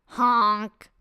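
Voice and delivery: a goofy nasal honk of a laugh; snorting honk. Retold or imitated. Narrator imitates his own snorting laugh, goofy honk.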